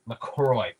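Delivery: LaCroix said as la-CROY, falling at the end; his own pronunciation. Shown as a demonstration. Settling the brand name for viewers, LaCroix said as la-CROY. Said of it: "that's exactly how I say it".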